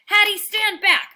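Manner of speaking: high, loud dog's shouted warning; barked warning. A narrator's reading. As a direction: bark it out to stop her. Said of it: "I bark".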